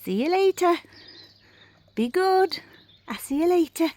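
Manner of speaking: high, loud goodbye to the dogs; sing-song farewell to pets. Real, not acted.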